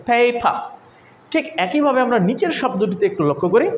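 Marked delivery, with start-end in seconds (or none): 0.1-0.5 পেপা as "paay-pa", first vowel long; lengthened A. Shown as a demonstration. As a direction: stretch the "ay" in পেপা. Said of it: "একটু লম্বা করে". (পেপা-র প্রথম এ টেনে লম্বা করে)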